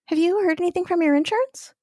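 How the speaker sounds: high, quick, chirpy receptionist voice; light imitation of a perky office worker; imitating the receptionist. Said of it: "and she's like".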